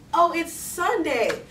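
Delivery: acting out a drawn-out "oh!" of realizing; sudden realization. Girl hears gospel music and realizes the day, surprised.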